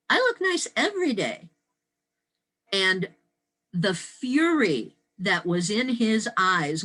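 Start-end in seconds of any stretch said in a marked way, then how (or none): 0.1-1.5 high-pitched quoted retort; retold pert comeback. Young teacher answering a colleague's compliment, retold high-pitched. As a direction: toss the reply back high. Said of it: "And I responded".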